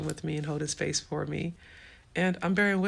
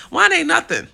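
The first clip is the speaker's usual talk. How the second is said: loud; 12 semitones higher, 12 dB louder than usual. Retold or imitated. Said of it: "I was like".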